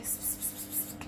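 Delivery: unvoiced psst to call the cat; soft psst-psst cat call; whispered psst calls. Real, not acted. Calling the cat over.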